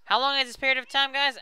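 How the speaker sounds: ordinary delivery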